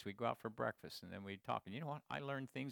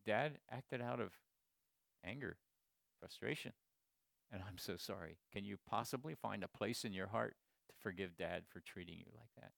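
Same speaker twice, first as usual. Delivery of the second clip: tender apology; 6 semitones lower, 6 dB quieter than usual. Retold or imitated. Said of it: "But instead I said".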